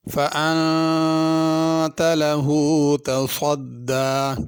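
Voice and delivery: slow, drawn-out melodic tajweed chant; Quran recitation. Acted. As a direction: recite slowly with long stretched vowels.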